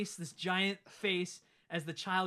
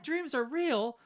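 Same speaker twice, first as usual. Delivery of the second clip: high, louder incredulous question; mock disbelief; 9 semitones higher, 6 dB louder, much slower than usual. Dad hearing dreams are real, mock surprise. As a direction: sell the shock of a baffled dad.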